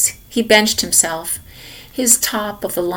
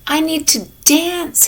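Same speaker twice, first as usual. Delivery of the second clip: correcting; 9 semitones higher, 4 dB louder than usual. A narrator's reading. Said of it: "Henry corrected".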